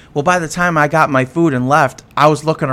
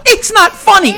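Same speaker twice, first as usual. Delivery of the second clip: indignant woman; 14 semitones higher, 5 dB louder than usual. Retold or imitated. Woman scolding the laughing narrator.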